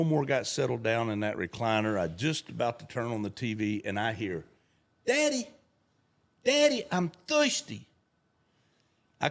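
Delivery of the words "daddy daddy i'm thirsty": put-on high, louder child call; little boy calling. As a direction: whine it like a small kid. Child voiced in story.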